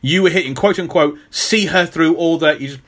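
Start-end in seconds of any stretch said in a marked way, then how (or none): none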